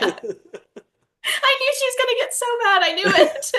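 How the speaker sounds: high, squealing through laughter; laughing hard. Real, not acted. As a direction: barely get it out for laughing. Cracking up over co-host's outburst.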